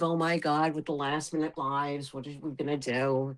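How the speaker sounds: usual speaking voice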